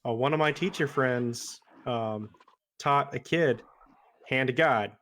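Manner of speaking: ordinary delivery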